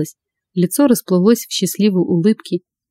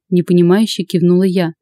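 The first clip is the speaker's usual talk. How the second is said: ordinary delivery, nothing marked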